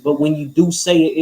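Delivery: usual speaking voice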